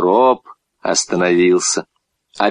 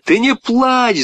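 high, urgent cry; boy's shout; 13 semitones higher, slower than usual. A narrator's reading. Boy telling crying girl to stop, shouting. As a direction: shout it across, pitched up. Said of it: "закричал Петя".

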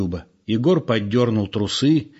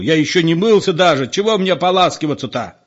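higher, louder outraged protest; raised voice, arguing hotly; indignant yell; 6 semitones higher, 4 dB louder than usual. A narrator's reading. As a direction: shout back in exasperation. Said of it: "заорал спокойный Петро".

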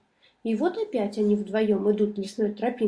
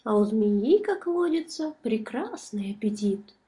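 plain reading voice

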